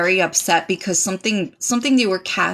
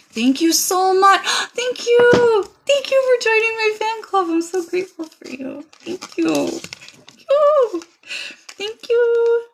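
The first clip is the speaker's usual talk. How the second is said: squeaky fan thanks; 12 semitones higher than usual. Acted. Gushing thanks, high squeaky voice.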